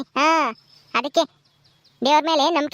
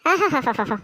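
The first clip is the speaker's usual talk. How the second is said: mocking laugh; 4 semitones lower, much slower than usual. Mocking aunt gloats at crying girl.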